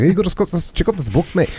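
put-on high-pitched, mumbled Scottish accent; mumbling Scotsman. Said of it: "he's a mumbler. He's more like".

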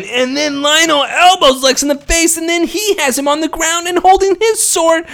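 high, eager play-by-play; excited retelling. Real, not acted. Friend recapping the fight, high and eager.

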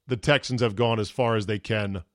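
usual speaking voice

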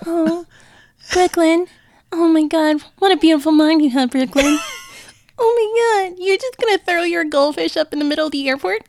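high, gushing mom parody; hammed-up sugary praise, pitched high; mock doting mom. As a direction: coo with exaggerated admiration at a child's mess. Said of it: "very different than Brooklyn's mom".

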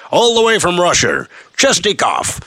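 announcer. Pretend. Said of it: "I suppose I should have said".

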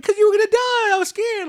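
loud, very high-pitched protest; retold scared outburst. Grandson tells grandma she nearly died, high scared outburst. Retells confessing his fear to grandma.

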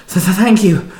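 huffing. A narrator's reading. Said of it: "the man huffed".

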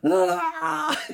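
high laughing scream; laughing shriek of a kid; mock scream. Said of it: "your kid just like".

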